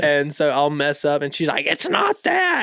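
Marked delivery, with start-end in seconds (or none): 1.7-2.6 very high, drawn-out mimicked objection; mock protest. Retelling her correction after a mess-up, high mock protest. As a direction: imitate her in an exaggerated high squeal.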